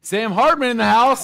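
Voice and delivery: hype announcer. Real, not acted. Host hyping an agent's name to the room. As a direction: call it out like a ring announcer.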